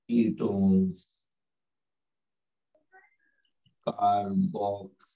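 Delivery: low, drawn-out list with long gaps; one term at a time, stretched and low; slow dictation. Real, not acted. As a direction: dictate each term slowly on a low, level pitch. Dictating list slowly while writing.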